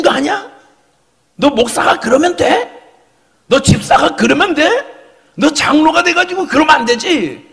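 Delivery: high, forceful telling-off; scolding. Acted. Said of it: "이렇게 꾸짖는 거예요". (높은 목소리로 호되게 꾸짖기)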